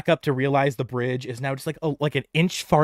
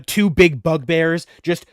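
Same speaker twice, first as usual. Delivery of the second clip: ordinary talk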